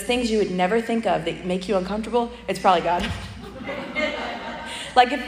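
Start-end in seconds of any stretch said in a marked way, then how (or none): 3.1-4.9 a quiet breathy chuckle; soft airy laugh. Real, not acted. Preacher chuckling after her joke about God, quiet airy chuckle. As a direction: chuckle under your breath.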